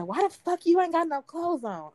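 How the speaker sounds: high, loud scolding brother; mock angry brother. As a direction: scold like a shocked big brother. Brother yelling at five am.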